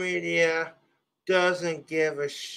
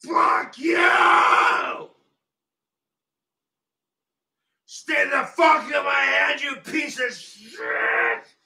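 much higher, cracking furious screams; crying rage; 6 semitones higher, 4 dB louder than usual. Real, not acted. Streamer screaming at objects that won't behave, crying rage. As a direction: scream it out on the edge of tears.

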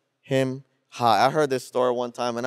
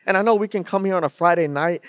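normal talking voice

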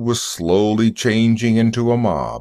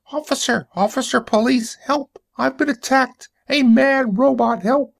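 screeched cry for help, very high; high-pitched screech. Acted. Fallen man calling police on a robot, high-pitched screech. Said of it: "screeched in a high-pitched voice".

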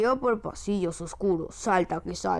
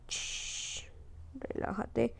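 quiet unvoiced shush then soft word; soft hush; 3 semitones higher, 10 dB quieter, much slower than usual. Gamer hushes the baby toward sleep. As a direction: settle him down softly.

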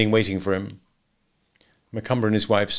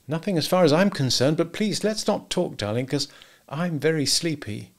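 higher-pitched, placating wife; sleepy brush-off; 6 semitones higher than usual. A narrator's reading. Wife to angry husband wanting to sleep, dismissive. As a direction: wave the argument away, pleading sleep.

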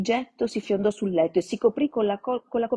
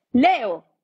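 sudden, much louder, higher yell of "Leo!"; shrill shout; 5 semitones higher, 10 dB louder than usual. Acted. Matron Olga catching Leo's prank, shrill shout. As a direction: bark his name from the dark hallway. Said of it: "La voce stridula".

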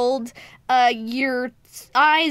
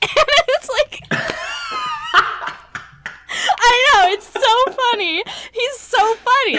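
loud, high-pitched gush of amusement; tickled delight; 12 semitones higher, 6 dB louder than usual. Real, not acted. Fan gushing to friend about a goofy character, delighted and loud. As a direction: gush at a high pitch, just after a big laugh. Cracking up over funny character.

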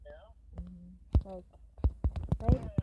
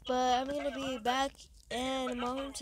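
normal talking voice

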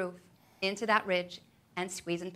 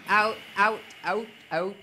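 forceful cue; 6 dB louder than usual. Acted.

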